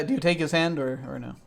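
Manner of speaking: normal talking voice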